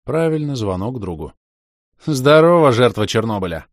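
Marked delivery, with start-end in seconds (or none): 2.0-3.7 loud, higher cheerful hello; joyful greeting. Acted. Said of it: "радостно отозвался ботаник". (радостное приветствие)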